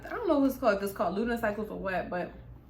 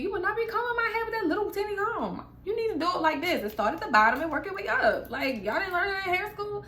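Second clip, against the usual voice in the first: exasperated rant; 8 semitones higher, faster than usual. Real, not acted. Ranting at hair stylists.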